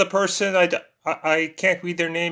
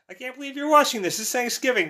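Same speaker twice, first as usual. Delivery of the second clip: high, fast, amazed; surprised; 8 semitones higher, faster than usual. Real, not acted. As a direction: rattle it off in delight. Surprised viewer watches on holiday.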